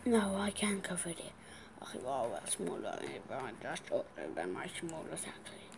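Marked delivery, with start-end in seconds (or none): 0.0-4.1 soft whispered worry. Real, not acted. Kid whispers to itself while losing. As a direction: whisper it to yourself.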